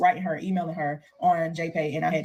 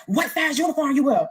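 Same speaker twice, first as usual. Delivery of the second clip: guard yelling; 7 semitones higher than usual. Retold or imitated. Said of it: "they, like, yelling at".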